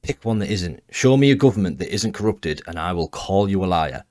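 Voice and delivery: ordinary talk, nothing marked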